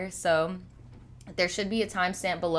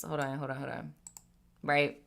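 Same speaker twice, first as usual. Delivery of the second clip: quiet aside; 4 semitones lower, 7 dB quieter than usual. Real, not acted. Quiet aside, fixing something.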